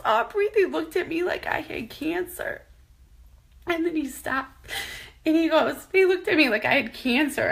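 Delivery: fighting back sobs while retelling; crying. Real, not acted. Retelling husband's humiliation, crying.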